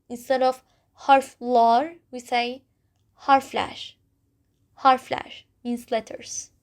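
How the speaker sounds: ordinary talk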